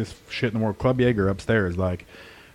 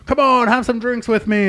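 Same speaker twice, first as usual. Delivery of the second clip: pushy customer; 11 semitones higher, 4 dB louder than usual. Retold or imitated. Customer urging drinks.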